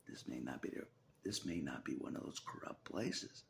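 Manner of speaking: ordinary talk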